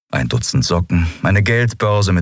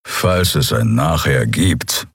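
a lower, slow growled line; lightly voiced menacing Kria, slow and low; 4 semitones lower, slower than usual. Keru answers Lien darkly, a low slow growl. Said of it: "knurrte Keru".

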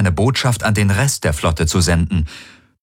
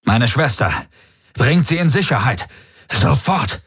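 urgent shout; 5 semitones higher than usual. Acted. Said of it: "Er rief sofort".

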